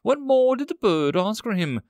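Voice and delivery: ordinary delivery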